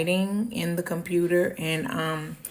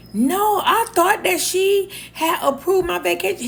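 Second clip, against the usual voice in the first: much higher and louder, defensive protest; high pleading protest; 12 semitones higher, 4 dB louder than usual. Retold or imitated. Explaining herself to the angry manager, high pleading. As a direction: squeak out a panicked excuse. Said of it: "And I said".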